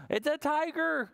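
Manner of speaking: high, thrilled announcement; excited discovery. Acted. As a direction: wow the kids with it. Spotting the tiger.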